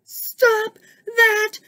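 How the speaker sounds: high, loud burst over the din; exasperated shout. A narrator's reading. Snake silences the noisy musicians, exasperated high shout. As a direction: shout them down, pitch way up. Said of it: "shouted Snake".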